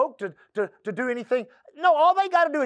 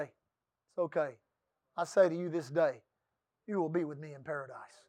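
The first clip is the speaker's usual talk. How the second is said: gentle Lord's reply; 8 semitones lower, 10 dB quieter than usual. Retold or imitated. The Lord reassuring the thief on the cross, softly. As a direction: speak as the Lord comforting gently. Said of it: "what'd the Lord say".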